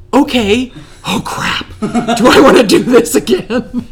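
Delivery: loud, high mock panic through laughter; laughing panic. She faces a third marriage proposal, retold in laughing panic. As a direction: crack up while retelling your own alarm.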